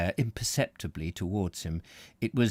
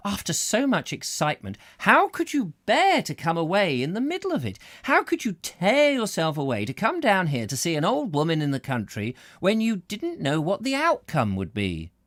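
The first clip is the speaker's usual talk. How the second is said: put-on high, gushing old woman voice; eager old lady; 9 semitones higher, 3 dB louder than usual. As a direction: gush like an admiring old lady.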